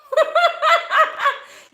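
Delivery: high, loud laugh; laughter. Real, not acted. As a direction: laugh at your own rant. Laughing after retold rant.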